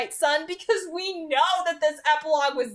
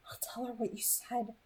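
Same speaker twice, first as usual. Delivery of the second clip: hushed whispered promise; soft secret whisper; 2 semitones lower, 15 dB quieter than usual. A narrator's reading. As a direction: breathe the line almost without voice. Bonnie answers after shuddering.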